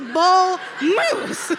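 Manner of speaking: bull moose punched loud and high; emphatic BULL MOOSE. Shown as a demonstration. Driving home the animal's size, emphatic bull moose. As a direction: belt out "bull moose" with a laugh.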